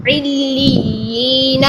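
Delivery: علیین very slow, yaa held; doubled yaa. Shown as a demonstration. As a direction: draw out علیین slowly. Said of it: "یہ جو ہے لی".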